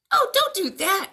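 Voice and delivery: high, loud teasing plea; mock-alarmed pig. Pig teasing the wolf, high mock alarm. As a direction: pretend to be scared.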